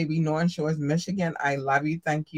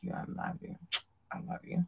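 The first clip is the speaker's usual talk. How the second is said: whisper; 2 semitones higher, 14 dB quieter, faster than usual. Acted. Soft thanks to YouTube viewers.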